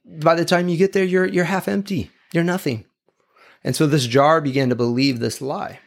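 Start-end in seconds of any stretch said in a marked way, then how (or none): 0.0-2.9 higher, sneering taunt at the cracked jar; the proud jar jeering at its cracked neighbour, higher and mocking; mocking perfect jar. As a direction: talk down to the broken jar, higher. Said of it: "would always make fun of the jar on the right".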